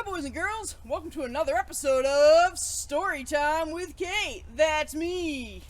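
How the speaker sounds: ordinary delivery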